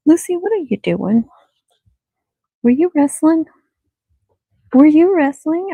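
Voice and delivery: high, loud teasing call to the dog; playful scolding. Real, not acted. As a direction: catch the dog mid-mischief, loud and high.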